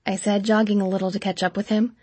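ordinary delivery, nothing marked

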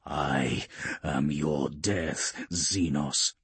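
low, slow, cold threat; menacing warrior. A narrator's reading. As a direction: speak it like a grim executioner. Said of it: "the beaky".

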